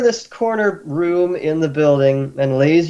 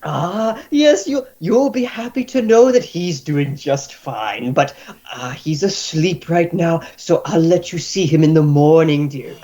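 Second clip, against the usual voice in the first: doting healer; 2 semitones higher than usual. Acted. Healer telling the drunk hero his friend is asleep. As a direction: reassure him kindly, like a nurse.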